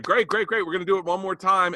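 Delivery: high, upbeat mock approval; imitating a showrunner's cheery praise. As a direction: pitch it high for the whole set to hear. Showrunner's public praise before private scolding.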